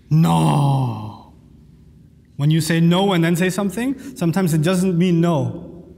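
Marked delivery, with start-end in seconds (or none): 0.1-1.1 one long, low "no"; mock-menacing, stretched refusal; ominous no. Shown as a demonstration. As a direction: draw it out low as a warning. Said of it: "when you're angry or upset".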